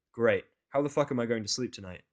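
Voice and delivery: ordinary delivery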